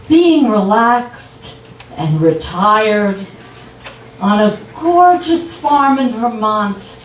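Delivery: slow daydreaming husband, imitated with long pauses; unhurried retold reverie; dreamy daydreaming. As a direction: drift slowly from phrase to phrase.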